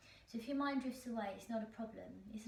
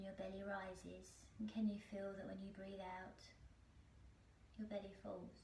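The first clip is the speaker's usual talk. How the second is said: guided meditation; 6 dB quieter than usual. Acted. Teacher guiding listeners through a body scan, calm meditation voice. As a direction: lead them gently through the breath, unhurried, phrase by phrase.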